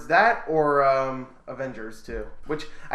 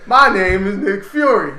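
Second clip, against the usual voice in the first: much louder, higher, slow hammed-up Fury; Samuel Jackson impression; 6 semitones higher, 12 dB louder, slower than usual. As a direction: bellow the name like a tough boss. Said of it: "That's my Samuel Jackson impression".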